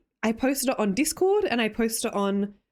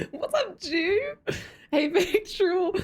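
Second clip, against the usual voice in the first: laughing; 8 semitones higher, slower than usual. Real, not acted. Greets chatter, laughing.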